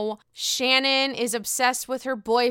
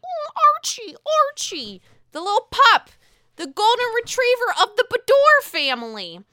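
high, louder doting coo; gushing over the dog; 11 semitones higher, 6 dB louder than usual. Real, not acted. Fawning over Archie the dog, high and louder. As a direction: coo over him like a beloved puppy.

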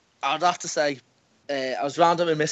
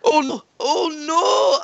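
high, drawn-out wail of horror; retold screaming; much slower than usual. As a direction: wail it slowly in mock panic.

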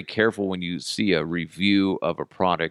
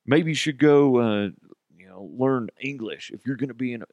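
condescending hillbilly; 4 semitones higher than usual. Retold or imitated. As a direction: spell it out like she's a child. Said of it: "he's just totally talking down to her".

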